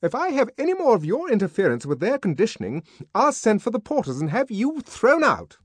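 high, loud, fast threat; truculent warning. Acted. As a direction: threaten him belligerently. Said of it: "she warned him truculently".

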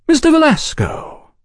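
high, cordial salute; smiling greeting. A narrator's reading. Oliphant turning to greet Velasco, smiling cordiality. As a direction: greet him with a smile in the voice. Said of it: "Oliphant smiled".

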